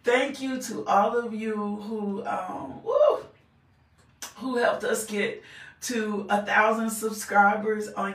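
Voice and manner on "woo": little woo. Real, not acted. Host thanks subscribers mid-sentence.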